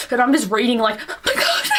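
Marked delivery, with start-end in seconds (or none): 1.1-1.8 very high, squeaked exclamation; hammed-up freak-out, pitch shooting up; overwhelmed reader. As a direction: squeal it in mock shock. Acting out her reaction while reading.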